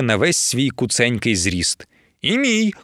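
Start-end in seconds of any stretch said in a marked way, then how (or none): 2.2-2.7 high-pitched little squeal. A narrator's reading. Lidiia joining her sister's claim, squealing. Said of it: "кувікнула Лідія".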